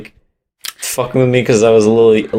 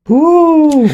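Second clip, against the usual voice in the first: high, loud ghostly ooh; a howling ghost moan; 4 dB louder than usual. Retold or imitated. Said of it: "people give ghost impressions just".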